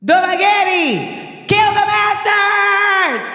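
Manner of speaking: high, very slow commanding shout; rally the army with a roar; commanding battle cry. Retold or imitated. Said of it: "then she's like".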